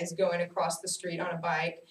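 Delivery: usual speaking voice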